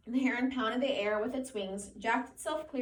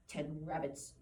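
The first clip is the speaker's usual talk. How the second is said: lower, softer scornful sneer; 6 semitones lower, 7 dB quieter, slower than usual. A narrator's reading. Giant rabbit mocks Lenny's dream, low scornful sneer. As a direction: sneer the words at him. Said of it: "it said scornfully".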